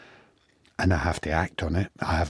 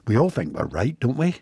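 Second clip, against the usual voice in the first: plain reading voice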